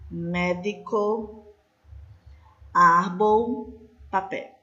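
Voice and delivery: slow, drawn-out words, one at a time; careful word-list reading. Shown as a demonstration. Teacher reading Spanish example words to the class, slow careful enunciation. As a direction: enunciate each word slowly, pausing between them.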